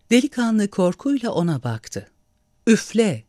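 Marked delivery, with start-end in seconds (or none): 2.7-3.2 higher-pitched, firm order; a raised, commanding call. A narrator's reading. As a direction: order it in one short burst. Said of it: "diye emretti".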